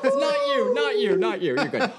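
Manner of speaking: laughing reassurance. Real, not acted. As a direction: laugh it out, light and high. Laughing while reassuring a friend.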